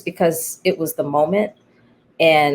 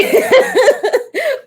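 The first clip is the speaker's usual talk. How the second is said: high laugh; 5 dB louder than usual. Real, not acted. Laugh after quote.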